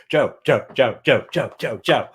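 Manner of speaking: Joe repeated in short falling bursts; imitated salutation versions. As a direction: fire off each Joe separately, dropping the pitch. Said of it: "all the different versions of just the salutation".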